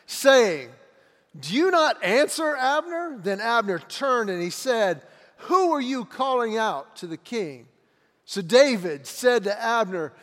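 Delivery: loud and high, David calling across the hills; dramatic scripture reading. Acted. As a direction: bellow the challenge at Abner. Reads David calling out to Abner.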